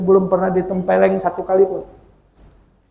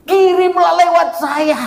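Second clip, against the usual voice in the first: punchline; 12 semitones higher, 5 dB louder than usual. Acted. Joke punchline prayer request.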